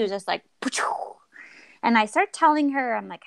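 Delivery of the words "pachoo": whispered whooshing sound effect; imitated airy brush-off noise; dismissive noise. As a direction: blow it out as a voiceless "pa-choo". Imitates the doctor's brusque response.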